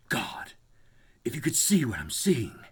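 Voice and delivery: hushed, trembling awe down the line; shaky whisper. Acted. Warren on the phone to Carter, shaky whisper. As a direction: breathe it out, shaken. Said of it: "in a shaky whisper".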